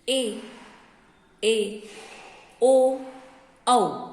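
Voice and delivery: each vowel called out clearly, one by one; teacher sounding out Hindi vowels for the class; reciting the vowels. Shown as a demonstration. Teacher drills grade three pupils on the vowels, calling each out clearly. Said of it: "स्वर कौन कौन से होते हैं देखिए अब आप".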